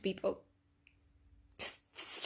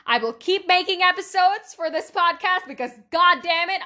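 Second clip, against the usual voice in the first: higher and louder, bursting with emphatic feeling; excited outburst; 7 semitones higher, 7 dB louder than usual. Real, not acted. Vowing to listeners to keep the podcast going, excited outburst. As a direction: blurt the promise out with real passion.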